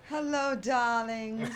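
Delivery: slow, loud, theatrical diva greeting; campy drag queen. Pretend. Red the intro voice greets.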